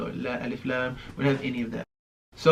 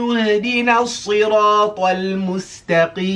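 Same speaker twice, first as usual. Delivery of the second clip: melodic chant without pausing for air; all in one breath; 4 semitones higher, 3 dB louder than usual. Shown as a demonstration. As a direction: recite it straight through on one breath. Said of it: "see if I can do the whole thing ... without taking a breath".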